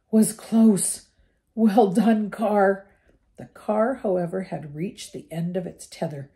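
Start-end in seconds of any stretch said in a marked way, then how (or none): 0.1-2.9 panting. Acted. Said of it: "panted Ron".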